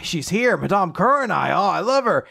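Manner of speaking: excited, swooning crowd voice; adoring excitement. Acted. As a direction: gush like a thrilled admirer. Adoring crowd sees its heroine.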